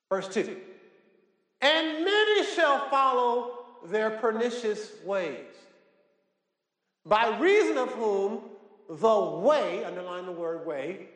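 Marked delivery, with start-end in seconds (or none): 1.6-9.8 high, slow declamatory Bible reading; preached scripture reading. Acted. Preacher reading scripture to the congregation, high, slow proclamation. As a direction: proclaim each phrase slowly, pitched up.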